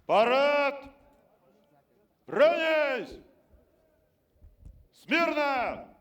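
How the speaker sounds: barked parade commands, loud; drawn-out drill command, then snap; shouting drill sergeant. Real, not acted.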